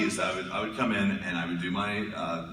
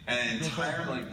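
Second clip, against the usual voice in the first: usual speaking voice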